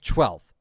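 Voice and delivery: low "twelfth" with a CH onset; mispronounced twelfth. Shown as a demonstration. Streamer tests the word on himself, mispronouncing "twelfth". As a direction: test "twelfth" as "chwelfth", low. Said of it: "I'm saying it like with".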